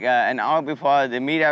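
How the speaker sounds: usual speaking voice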